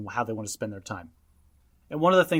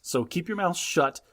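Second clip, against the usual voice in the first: ordinary talk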